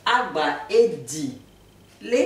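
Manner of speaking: agba edi le said slowly and carefully; teacher drags out agba edi le syllable by syllable; Akan word demo. Shown as a demonstration. A teacher to viewers, slowly saying the Akan phrase. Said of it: "la même chose avec le froid".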